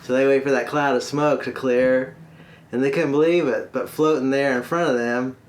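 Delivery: usual speaking voice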